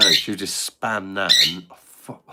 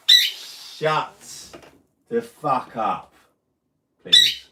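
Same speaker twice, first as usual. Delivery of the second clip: exasperated shout at the bird; annoyed yell; 3 semitones higher, much slower than usual. Real, not acted. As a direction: drag it out, fed up with the noise. Yelling at the parrot again.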